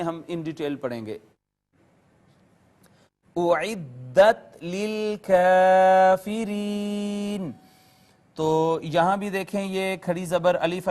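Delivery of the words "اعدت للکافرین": slow, drawn-out tajweed chant; Quranic recitation. Shown as a demonstration. Teacher recites the verse for students, slow chant. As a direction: stretch each vowel as in recitation.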